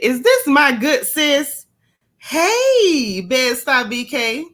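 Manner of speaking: excited, loud, high hello; delighted greeting. Real, not acted. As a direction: greet your best friend with a big, drawn-out squeal of welcome. Spots a close friend in chat.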